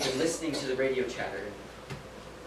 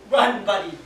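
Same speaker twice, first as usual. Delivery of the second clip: urgent yell; 3 semitones higher, 9 dB louder than usual. Retold or imitated. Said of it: "I yell".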